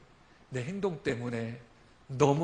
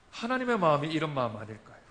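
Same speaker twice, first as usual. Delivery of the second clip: normal talking voice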